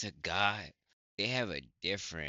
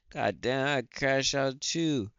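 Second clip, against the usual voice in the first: emphatic; 6 semitones higher, 6 dB louder than usual. Real, not acted. Heated reaction to the story.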